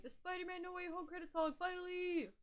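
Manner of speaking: high-pitched mock excitement; teasing, over-keen high voice. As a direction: imitate a friend gushing in a squeal. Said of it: "[name] saw all of De La Soul's albums released".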